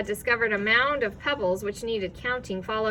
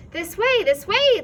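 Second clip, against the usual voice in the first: higher, loud call; 10 semitones higher, 9 dB louder than usual. A narrator's reading. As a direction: call them over from afar. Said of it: "the voice called again".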